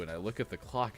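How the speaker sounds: normal talking voice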